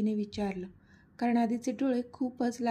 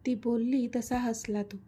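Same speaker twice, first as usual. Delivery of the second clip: ordinary delivery, nothing marked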